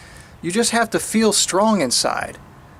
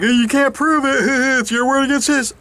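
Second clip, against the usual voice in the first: mocking lazy cop; 6 semitones higher than usual. Cop refusing the crime report, high mocking voice. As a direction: brush him off like a bored officer, pitched up. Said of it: "Cop's like".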